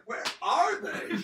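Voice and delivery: high, loud, stretched-out wail, laughing; mock indignant cry. Player as Mister Gripvald left waiting, hammed-up loud cry. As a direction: wail it slowly as a joke.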